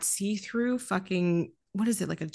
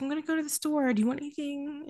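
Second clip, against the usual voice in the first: sweet eager voice; 7 semitones higher, 3 dB quieter, faster than usual. Retold or imitated. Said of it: "he's like".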